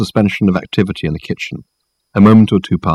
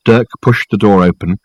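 usual speaking voice

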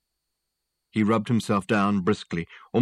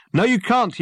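high, loud contradiction; yelling; 14 semitones higher, 6 dB louder than usual. A narrator's reading. Rescuer overruling a floundering man, yelling. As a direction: yell back urgently. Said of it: "yelled Dirk".

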